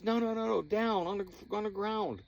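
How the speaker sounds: high, loud frantic pointing out; exasperated, steer them to look down; urgent insisting. Retold or imitated. Friend pointing at the skunk, high loud urgent. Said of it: "finally he goes".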